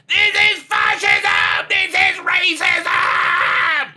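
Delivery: hammed-up scream at full volume; top-of-the-lungs mocking shriek, stretched out. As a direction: imitate an outraged protester screaming, words dragged out slowly. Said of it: "screaming at the top of your lungs".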